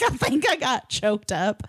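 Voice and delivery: usual speaking voice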